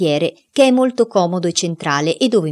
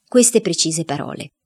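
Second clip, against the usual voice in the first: ordinary delivery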